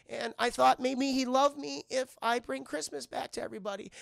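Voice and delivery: high, innocent little pleading voice; earnest Olive. Retold or imitated. Olive telling Santa about the owner, pleading.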